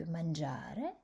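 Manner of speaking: mangiare with a long, stressed middle syllable -gia-; stress on second-last syllable. Shown as a demonstration. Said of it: "sur l'avant-dernière syllabe". (accent tonique sur « gia » dans mangiare)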